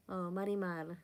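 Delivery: ordinary talk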